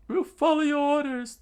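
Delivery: high, loud eager soldier; eager guard. Acted. Guard pledging to follow Chaka, high and loud. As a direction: shout your loyalty in a squeaky voice. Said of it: "the guard said".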